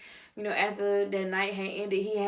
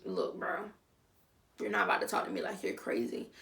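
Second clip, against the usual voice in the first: quiet warning; 2 semitones lower, 7 dB quieter than usual. Acted. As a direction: set a boundary under your breath.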